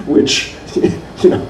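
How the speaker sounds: breathy laughter through the words; laughing. Real, not acted. Speaker amused telling audience about gushing blurbs, laughing. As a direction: laugh at how over the top the blurbs are.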